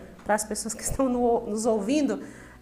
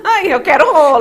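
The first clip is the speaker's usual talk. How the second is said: laughing, loud and high; laughing echo; 5 semitones higher, 14 dB louder than usual. Real, not acted. Interviewer laughing along with the guest's wish. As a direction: laugh the words out with her.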